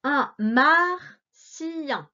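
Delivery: very slow, "martien" split into clear beats; "martien" stretched out syllable by syllable; marking syllables. Shown as a demonstration. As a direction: mark each syllable of "martien". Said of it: "en marquant les syllabes".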